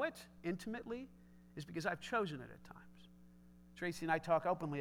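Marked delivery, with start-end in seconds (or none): 1.6-3.1 unvoiced, hushed admission; whispered confession. Real, not acted. Pastor confessing his own marriage failings, whispered. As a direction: let it slip out as a whisper.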